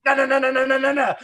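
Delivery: high, loud repeated no; frantic no's. Retold or imitated. Tim protesting as the rhythm collapses, loud. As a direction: panic as the rhythm dies. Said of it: "He was just like".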